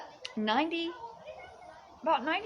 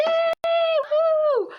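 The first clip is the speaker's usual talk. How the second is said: much higher, loud woo; whoop; 8 dB louder than usual. Real, not acted. Whooping with joy to viewers. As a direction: let out a joyful whoop.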